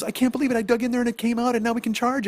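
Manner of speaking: high, gushing disbelief; can't believe her luck; thrilled. Retold or imitated. Wife thrilled the phone charges.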